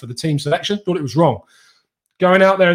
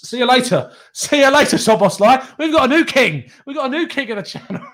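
higher, louder gleeful send-off; gleeful taunt; 8 semitones higher, 4 dB louder than usual. Real, not acted. A fan gleefully waving off a player, higher and louder. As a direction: crow it like a winning fan.

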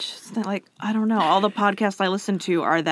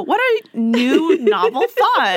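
higher, slower sarcastic wonder; mock amazement; 4 semitones higher, 4 dB louder, slower than usual. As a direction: gush with exaggerated fake admiration. Parodying the contradictory reviewer.

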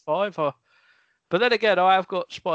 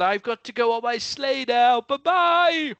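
Santa farewell; 5 semitones higher, 4 dB louder than usual. Acted. Santa leaves on sleigh.

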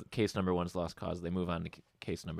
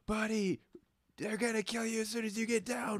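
higher urgent warning, softly; warning a friend; 14 semitones higher, 5 dB quieter than usual. Retold or imitated. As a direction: urge him to run, pitched up. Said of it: "he says".

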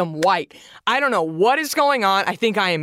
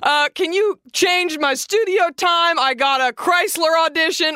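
mock demanding yell; 7 semitones higher than usual. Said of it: "I say".

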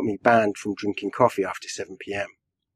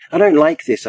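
raised, louder protest with a high peak; crying out; 6 semitones higher, 5 dB louder than usual. A narrator's reading. Rabbi hovering over the mourners unheard, crying out. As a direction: cry it out in alarm. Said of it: "I cry".

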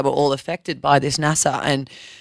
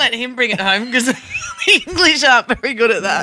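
teasing; 7 semitones higher, 8 dB louder than usual. Acted. Teasing banter about the English.

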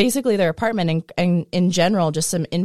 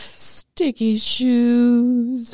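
drawn-out catchphrase; 5 semitones higher, much slower than usual. Acted.

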